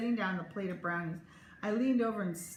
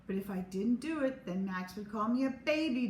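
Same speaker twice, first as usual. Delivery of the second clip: ordinary delivery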